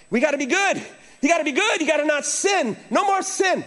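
high-pitched, flustered mock desperation; imitating a frantic kid under questioning; frustrated guessing. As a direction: grasp for answers, pitch climbing as you go. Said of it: "he could tell I was getting frustrated".

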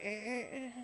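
soft, cowed little stammer; meek stammer. Retold or imitated. Honking driver cowed by the angry man, meek stammer. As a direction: squeak it out timidly. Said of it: "and he's like".

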